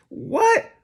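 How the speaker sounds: a sudden high, incredulous "What?"; shocked what. Real, not acted. Host reacting to the claim, disbelieving and high. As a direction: yelp it in disbelief.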